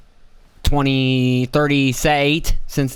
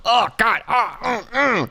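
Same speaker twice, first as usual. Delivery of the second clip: high-pitched, hammed-up groans; mock moaning; 9 semitones higher, slower than usual. Mimicking people talking about Trump, high mock moaning. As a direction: moan in a high, put-on voice.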